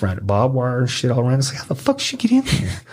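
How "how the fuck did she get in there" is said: high-pitched incredulous question; retold bewildered squawk; baffled outburst. Baffled reaction in retold story.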